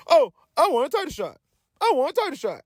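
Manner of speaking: whiny mock. Mocking wrestlers complaining.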